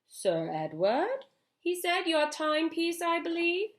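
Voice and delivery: bow and hand it over with irony; mocking. A narrator's reading. Father returning the watch with a mocking bow: mock-formal. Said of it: "with a mocking bow".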